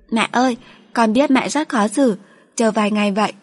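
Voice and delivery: put-on a little girl giving in gently; young daughter.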